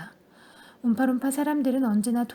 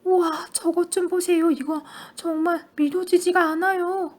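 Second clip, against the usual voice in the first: higher, louder astonished cry; amazed shout; 5 semitones higher, 4 dB louder than usual. A narrator's reading. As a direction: gasp out in wonder. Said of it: "큰 소리로 외쳤다".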